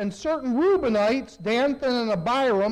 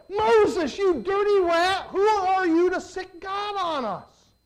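high, hammed-up indignant yell; mock angry accusation; 7 semitones higher than usual. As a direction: accuse Moses like a cartoon villain. Leaders accuse Moses.

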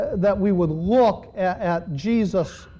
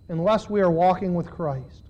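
ordinary delivery

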